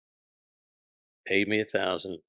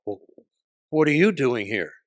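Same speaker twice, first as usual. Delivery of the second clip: louder, higher burst after a stunned beat; retold reaction as if seeing a ghost; imitating startled shock; 4 semitones higher, 7 dB louder than usual. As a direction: blurt it out, taken aback. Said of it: "like he saw a ghost".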